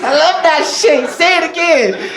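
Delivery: high-pitched, hammed-up eager urging; mock aroused excitement. Comic egging on his girlfriend's accent, mock excited delight. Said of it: "I'll be like".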